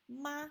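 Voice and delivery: high, level "ma"; even, sustained high tone on "ma"; first tone. Shown as a demonstration. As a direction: hold "ma" on one high pitch. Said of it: "one, two, three".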